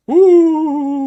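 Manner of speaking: loud sudden high spooky ooh; jump-scare the audience with a shriek; startling boo. Pretend. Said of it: "did I scare you?".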